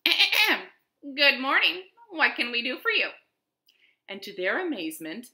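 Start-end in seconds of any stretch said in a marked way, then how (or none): 0.0-3.1 loud, higher formal greeting after throat-clearing; chief frog speaking up. A narrator's reading. Chief frog greeting the visiting pig, speaking up officially. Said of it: "The chief frog spoke up".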